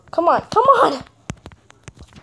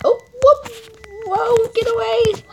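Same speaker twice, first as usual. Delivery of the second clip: startled squeal; 10 semitones higher than usual. Real, not acted. Startled by a player.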